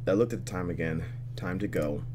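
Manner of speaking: ordinary talk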